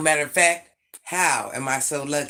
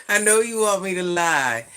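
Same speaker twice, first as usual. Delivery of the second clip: teasing, louder; 3 semitones higher, 6 dB louder than usual. Real, not acted. Still laughing, teasing chat.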